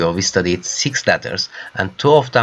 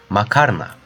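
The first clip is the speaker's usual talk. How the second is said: usual speaking voice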